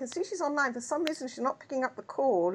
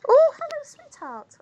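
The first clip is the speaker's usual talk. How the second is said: delighted greeting; 12 dB louder, slower than usual. Real, not acted. Daughter walks into the room.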